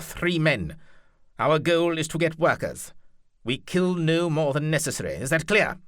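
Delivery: stern duke ordering his knights; commanding duke. Acted. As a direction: lay down the terms like a ruthless noble. Duke Leopold commands his captains.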